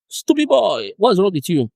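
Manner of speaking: high-pitched, exaggerated telling-off; mock scolding. Acts a discipler shaming a disciple.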